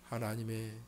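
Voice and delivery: usual speaking voice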